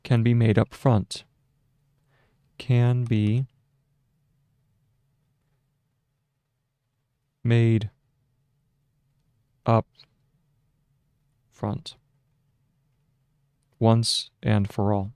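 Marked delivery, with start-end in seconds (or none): 2.6-12.0 dictating. Shown as a demonstration. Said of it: "so that's period".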